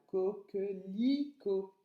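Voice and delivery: Coquelicot drawn out slowly by syllable; "Coquelicot", slow, with the I sound held; stress on I. Shown as a demonstration. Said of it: "on entend le son I sur la troisième syllabe".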